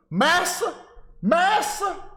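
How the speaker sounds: high, loud, hammed-up pleading cry of "Massa"; over-the-top mock servant wail.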